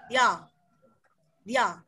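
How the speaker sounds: ordinary delivery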